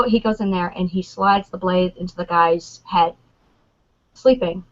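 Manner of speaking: normal talking voice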